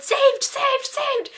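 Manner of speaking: high shrieked claim on every saved; a kid's shrill screamed saved, saved, saved; screaming the word. A narrator's reading. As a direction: shriek it like a kid guarding a bus seat. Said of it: "keep screaming the word".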